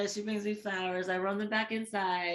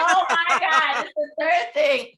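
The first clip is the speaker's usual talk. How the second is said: mortified exasperation; 6 semitones higher, 9 dB louder than usual. Retold or imitated. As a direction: drag it out in dismay. Misspelled her name on dessert.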